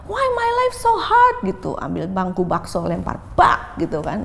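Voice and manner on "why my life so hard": high, loud and slow lament; despairing cry. Retold or imitated. Depressed mother crying out about her hard life. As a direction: wail it out slowly in despair. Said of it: "Terus suka bilang".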